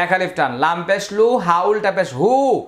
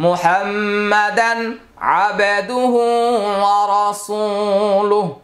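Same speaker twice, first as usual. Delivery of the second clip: the full tashahhud chanted slowly and higher in a melodic recitation; 3 semitones higher, much slower than usual. Shown as a demonstration. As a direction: recite it like a prayer, drawn out and sung. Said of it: "আমি আরেকবার সবটা বলে দিচ্ছি". (ধীরে, উঁচু সুরে পুরো তাশাহুদ তিলাওয়াত)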